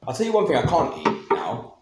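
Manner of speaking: ordinary talk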